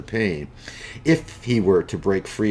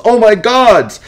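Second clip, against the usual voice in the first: shrieking at the top of the voice, much higher and louder; panicked scream; 12 dB louder than usual. Acted. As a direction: scream it in terror, pitch soaring. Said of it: "he screams".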